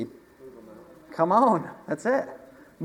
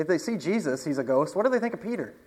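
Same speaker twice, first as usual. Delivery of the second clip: usual speaking voice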